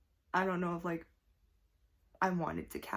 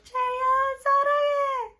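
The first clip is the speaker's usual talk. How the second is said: very high, loud fan shriek; Korean: 목이 터져라 외치는 비명; ugly scream; 10 dB louder, faster than usual. Retold or imitated. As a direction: shriek it with everything you have. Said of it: "a very, like, ugly scream of".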